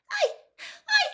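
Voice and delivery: very high, loud squeaky elf gasping in panic; Winky. A narrator's reading. Accused house-elf gasps a denial to the wizard, panicked squeak. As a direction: squeal it tearfully, stammering at the start. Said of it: "Winky gasped".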